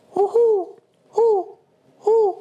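high falsetto hoo hoo hoots; playful high owl hooting. Retold or imitated. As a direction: hoot like an owl at night. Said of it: "a sound that the owl makes".

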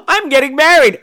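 high, excited daughter voice; high-pitched, gleeful announcement. Acted.

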